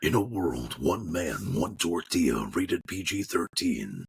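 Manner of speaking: deep, slow trailer announcer; low, drawn-out movie-trailer narration. Shown as a demonstration. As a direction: boom it out low like an epic film trailer. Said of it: "good at that movie, um, trailer voice".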